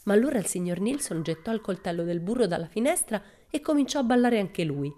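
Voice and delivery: ordinary delivery, nothing marked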